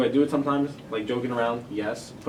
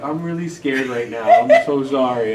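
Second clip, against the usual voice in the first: higher, loud, drawn-out imitation of a scared student; mock-frightened student, swelling louder; mock scared apology; 4 semitones higher, 11 dB louder, slower than usual. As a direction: plead sorry in a put-on scared voice, half laughing.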